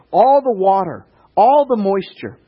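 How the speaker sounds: high, loud and slowing on all; preaching climax. Acted. Preacher describes the fire consuming everything, excited. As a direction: build it with awe.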